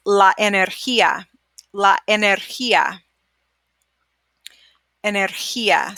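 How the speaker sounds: energía with the g as a breathy h; Spanish g. Shown as a demonstration. Teacher modelling the g as h for students. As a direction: say energía with an h sound for the g. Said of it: "it makes an H sound".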